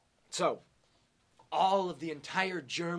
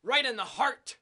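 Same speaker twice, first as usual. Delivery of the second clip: dramatic loud slow narration; 9 semitones higher, 9 dB louder, slower than usual. Acted.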